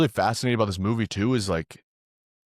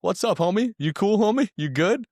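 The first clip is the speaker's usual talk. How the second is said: much higher, louder mock-innocent voice; sweet-talking dad; 12 semitones higher, 4 dB louder than usual. Dad brushing off his daughter, hammed-up high innocence. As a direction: cover it up with a falsetto sweetness. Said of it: "He's like".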